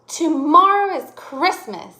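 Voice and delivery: loud, high, drawn-out excited announcement; excited exclamation. Shown as a demonstration. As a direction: call it out with excitement, stretching the words. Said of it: "Did I sound excited".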